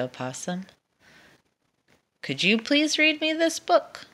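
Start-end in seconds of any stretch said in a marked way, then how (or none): 2.2-4.1 high, pleading request; hopeful asking. Acted. Dragon pleading with the possum, high and hopeful. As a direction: lift it up, hoping for a yes.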